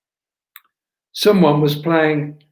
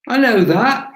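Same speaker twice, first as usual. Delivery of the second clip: louder, higher blustering protest; 8 semitones higher, 5 dB louder than usual. A narrator's reading. Sydney bristling at Gabriella's warning, blustering. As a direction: bluster it defensively, pitch up. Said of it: "he blustered".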